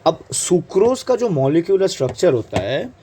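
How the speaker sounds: normal talking voice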